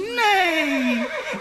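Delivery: playful high neigh with a laugh; whinny it out, laughing; horse neigh. Retold or imitated. Mother imitates horse for child.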